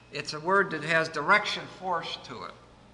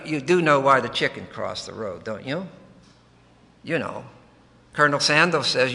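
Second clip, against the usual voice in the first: ordinary talk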